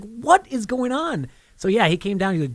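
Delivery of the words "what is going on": slow, high-pitched mock disbelief; retold exasperated bafflement. Dad retelling his reaction to the kids, baffled. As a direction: draw it out high, as a baffled parent.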